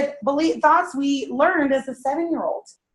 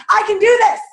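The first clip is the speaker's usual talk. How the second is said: louder and higher, pushing the crowd on; louder affirmation; 10 semitones higher, 7 dB louder than usual. Shown as a demonstration. After calling the first try lame, a louder affirmation. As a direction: belt it out to show them how. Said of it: "Okay, that was lame".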